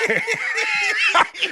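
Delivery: a real high-pitched laugh bursting out; high burst of laughter. Real, not acted. Telling a friend a ridiculous story, real laugh.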